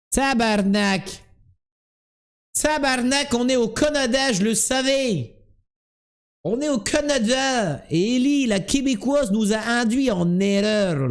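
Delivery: high-pitched outraged Québécois outburst; Québécois accent. Acted. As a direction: wail it in a hammed-up Québécois accent. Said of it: "quand tu imites les Québécois".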